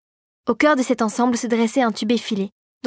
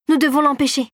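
Clara cries out to stop it; crying out; 5 semitones higher than usual. A narrator's reading. Girl protests to the fairy, urgent cry. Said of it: "s'écria Clara".

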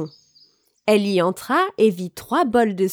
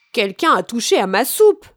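louder storybook father bear; Papa Bear crying out; 5 dB louder than usual. A narrator's reading. Papa Bear finding his soup touched, crying out. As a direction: cry out as a put-out Papa Bear. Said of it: "s'écria papa ours".

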